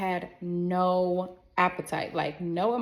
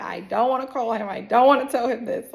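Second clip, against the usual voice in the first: mock tearful whine, raised voice; retold crying; 3 semitones higher, 5 dB louder than usual. Woman dreading the call to him, imitated tearful protest. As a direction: protest like you're crying, lightly acted. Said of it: "Now I'm really crying 'cause I'm like".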